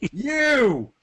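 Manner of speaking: very high-pitched shouted summons; single out one person with a shout; Tybalt calling out. Acted. Lord pointing at a bystander, calling out.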